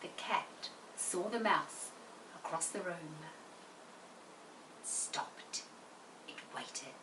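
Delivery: tense whispery storytelling; slow and hushed. Shown as a demonstration. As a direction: drop to a hushed, slow hush. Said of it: "I've used slower bits".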